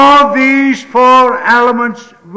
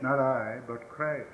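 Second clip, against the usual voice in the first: much lower and far softer, reverent; hushed solemn; 7 semitones lower, 7 dB quieter than usual. Real, not acted. Preacher hushing the congregation when naming Christ, very soft and low. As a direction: drop to a hushed reverent hush.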